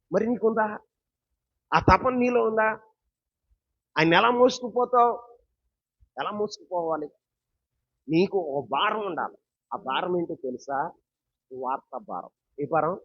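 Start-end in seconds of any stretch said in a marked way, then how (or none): none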